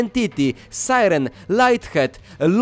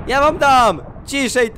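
loud higher playful threat; mock threat; 6 semitones higher, 5 dB louder than usual. Real, not acted. Player shouting at whoever makes thunder, mock threat. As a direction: shout a mock warning at the storm.

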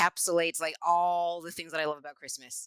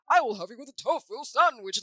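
deep Grinch voice; 4 semitones higher, 4 dB louder than usual. Retold or imitated. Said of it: "how he pronunciates words".

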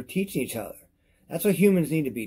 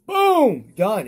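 sudden high, loud boom; loud mock boom; 8 semitones higher, 8 dB louder than usual. Showing viewers the product breaking, a loud high boom. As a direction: slam the word like something breaking.

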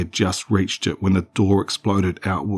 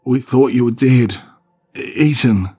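higher, louder tearful outburst; tearful cry; 5 semitones higher, 6 dB louder than usual. Acted. Mother weeps to her son that she thought him dead, tearful. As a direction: cry it out, stumbling on eaten. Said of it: "Mum cried, tears flowing down".

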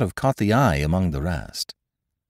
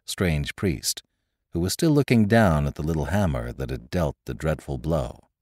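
ordinary delivery, nothing marked

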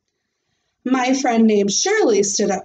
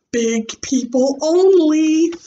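big people said slower and higher; stress on big people; 7 semitones higher, 4 dB louder than usual. Acted. Junie B repeating what Daddy said, stressing big people. Quoted phrase emphasized.

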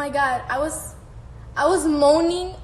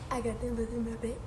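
much softer and quicker; quiet aside; 3 semitones lower, 11 dB quieter, faster than usual. Real, not acted. Repeating the line to herself, a quiet aside. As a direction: murmur it to yourself, fast.

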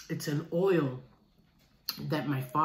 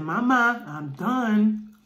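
put-on a grandmother's proud, raised toddler voice, louder and higher; imitating toddler; 3 semitones higher, 6 dB louder, much slower than usual. Grandma proudly quoting her grandson's toddler voice. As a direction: chirp it out like a little boy announcing he's finished. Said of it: "he said".

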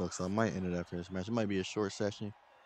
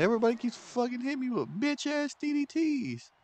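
mock whine. Whining about being hit repeatedly.

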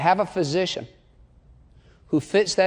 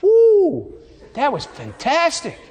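higher, hammed-up wowed theatregoer; mock rave review; 6 semitones higher, 3 dB louder, slower than usual. Theatregoer as the curtain closes, hammed-up amazement. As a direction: whoop and gush in mock awe.

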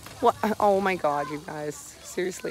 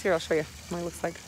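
ordinary talk

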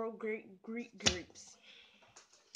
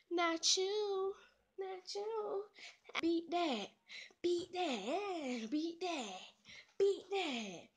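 high, drawn-out victory taunt; gloating taunt; 5 semitones higher, slower than usual. Real, not acted. Player taunting viewers after a win, gloating. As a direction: stretch it out and rub it in.

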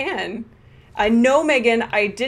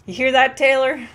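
louder, playful taunt at the PS5's owner; mock taunt; 3 semitones higher, 5 dB louder than usual. Streamer joking to her friend about keeping the borrowed console, mock taunting. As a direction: tease the owner with fake menace. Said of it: "I can't even say that seriously".

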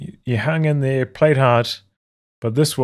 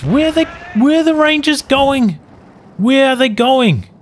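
real exasperation; 11 semitones higher than usual. Real, not acted. Caster fuming at the player's strange moves, high exasperated cry. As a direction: yell at the screen in real frustration. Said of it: "I'm so angry".

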